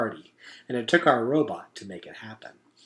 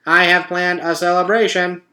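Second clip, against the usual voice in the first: upbeat robot, higher and louder; a cheerful machine making a speech; 5 semitones higher, 6 dB louder than usual. A narrator's reading. Roz announcing the party truce to the animals, perky robot.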